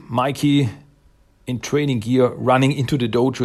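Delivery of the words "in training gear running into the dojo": normal talking voice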